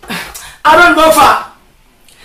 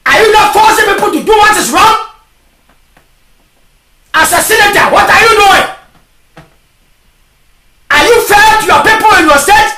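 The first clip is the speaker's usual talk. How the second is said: full-force preaching; 5 semitones higher than usual. Real, not acted. Preacher challenging officials to do right, forceful preaching. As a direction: preach it hard, question after question.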